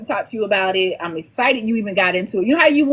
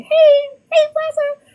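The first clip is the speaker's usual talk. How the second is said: high, loud, playful hail; delighted high-pitched shout-out; excited greeting; 6 dB louder, much slower than usual. Acted. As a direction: call out to a friend, pitched way up. Acted greeting to a reader.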